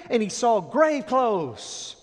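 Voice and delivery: drawn out very slowly, loud; slow reveal. Acted. Preacher slowing down for the grave clothes, deliberate. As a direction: let each word land.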